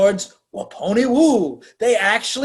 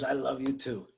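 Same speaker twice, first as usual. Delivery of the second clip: low, soft romantic answer; understated lover's reply; soft retold reply; 5 semitones lower, 11 dB quieter than usual. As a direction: quietly imitate a man returning love.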